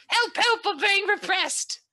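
very high, stretched-out British squawk; old bad British. Player showing the bad British accent meant, high and drawn out. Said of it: "an old bad British".

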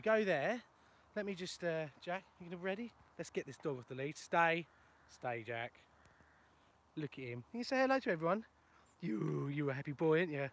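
ordinary talk

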